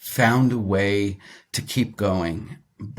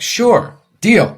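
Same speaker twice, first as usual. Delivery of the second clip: sharply higher, louder, eager; eager teen agreement; 11 semitones higher, 8 dB louder than usual. Retold or imitated. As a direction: jump at the deal like a thrilled seventeen-year-old. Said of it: "I was seventeen and I was like".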